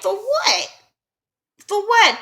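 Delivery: high, mock-outraged disbelief; a hammed-up high outraged protest; incredulous. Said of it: "And I'm sitting there like".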